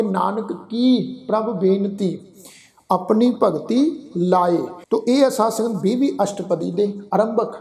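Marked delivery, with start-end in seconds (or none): 0.0-4.6 Nanak ਕੀ said together, then a break before ਪ੍ਰਭ; slow recitation with the pause placed after ਕੀ. Shown as a demonstration. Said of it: "ਇੱਥੇ ਅਸੀਂ ਵਿਸ਼ਰਾਮ ਦੇਵਾਂਗੇ".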